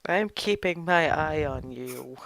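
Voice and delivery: low, slow, measured threat; warning Goliath. Acted. Goliath warns the intruder, low slow menace. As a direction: warn slowly and low, as a watchful giant.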